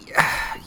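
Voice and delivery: breathy exhaled sigh; heavy sigh. Real, not acted. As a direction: let out a sigh before answering. Weighing who to keep happy.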